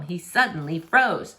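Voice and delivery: usual speaking voice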